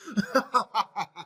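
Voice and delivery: a quick amused laugh; short laugh. Real, not acted. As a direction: chuckle at the punchline. Laughs at joke ending.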